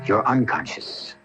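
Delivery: usual speaking voice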